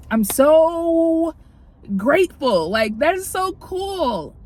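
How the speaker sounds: high, drawn-out gush of thanks; gush, stretching out the key words high; gushing gratitude. Real, not acted. Vlogger thanking parents for an iPad, gushing.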